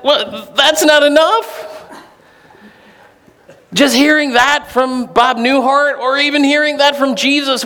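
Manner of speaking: ordinary talk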